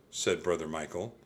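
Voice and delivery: ordinary delivery, nothing marked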